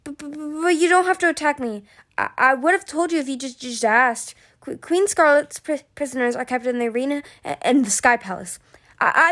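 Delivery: high, whiny, stuttering plea; nervous stammering. A narrator's reading. Pinned captive tells what she knows, nervous stammering.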